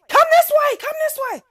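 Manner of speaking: high, loud urging to come; urgent calling. Retold or imitated. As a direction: shout her over, fast and worried. Mother urging daughter toward her.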